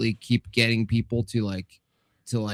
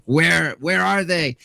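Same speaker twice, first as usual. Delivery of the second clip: interrogating officer; 5 semitones higher, 4 dB louder, slower than usual. Acted. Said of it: "they're interrogating you".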